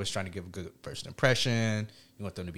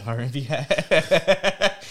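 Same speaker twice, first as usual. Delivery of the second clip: laughing; 5 semitones higher, 7 dB louder, much slower than usual. Real, not acted. Repeating nickname laughing.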